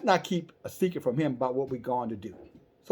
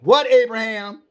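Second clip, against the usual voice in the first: exasperated angels; 7 semitones higher, 9 dB louder than usual. Acted. Angels exasperated at more questions.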